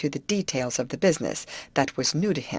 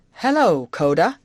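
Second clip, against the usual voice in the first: calling out; 2 semitones higher, 8 dB louder than usual. A narrator's reading. Hammond hailing his old friend, calling out. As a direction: call a greeting across a clearing. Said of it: "called Mister Hammond".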